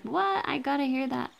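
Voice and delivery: hammed-up high voice begging to hear the appendix; imitating eager viewers. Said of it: "now you guys are like".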